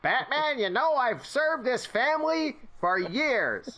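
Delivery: very high, slow celebrity impersonation; David Lynch. Retold or imitated. Player as Alfred scolding Batman, David Lynch voice. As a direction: stretch it out in mannered indignation. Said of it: "get David Lynch to be Alfred".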